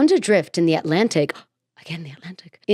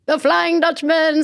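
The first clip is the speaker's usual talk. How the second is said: high, slow ghostly cartoon call; hammed-up spooky cartoon ghost; Flying Dutchman voice; 9 semitones higher than usual. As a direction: wail it like a cartoon ghost captain. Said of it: "the character in SpongeBob that's like".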